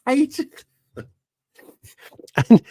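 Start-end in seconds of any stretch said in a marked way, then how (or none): 0.1-0.6 hated jumps way up high; squeaky high repeat. Real, not acted. Telling viewers how he hated the film, high squeak.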